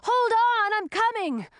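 high, urgent shout; calling out. A narrator's reading. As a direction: call out desperately. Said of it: "he called out to the dragoness".

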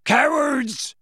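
high, loud angry roar; furious giant's yell; Hagrid's bellow. Acted. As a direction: hurl the word at the attackers. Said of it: "bellowed Hagrid".

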